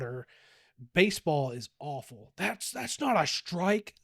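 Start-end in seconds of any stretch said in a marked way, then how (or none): 2.4-3.9 high, mocking sports-parent yell; mock angry parent. Parent yelling at the umpire, mocking. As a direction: mimic a sideline dad losing it.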